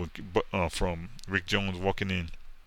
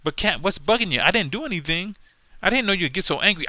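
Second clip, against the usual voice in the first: higher, pleading sidekick voice; high hammed-up whine; whiny sidekick; 12 semitones higher, 7 dB louder than usual. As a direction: beg innocently in a high put-on voice. Acted quarrel, sidekick pleading.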